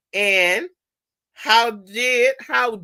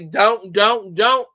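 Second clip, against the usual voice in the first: louder, insistent repeated don't; nagging don't; 5 dB louder, slower than usual. Retold or imitated. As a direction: mimic a parent nagging over and over. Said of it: "the more you say".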